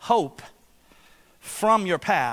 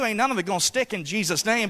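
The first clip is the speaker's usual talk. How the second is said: ordinary delivery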